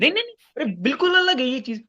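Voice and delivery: high, loud burst of protest; sharp protest. Real, not acted. Arguing with a debater, he bursts out in high protest. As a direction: cut him off in disbelief.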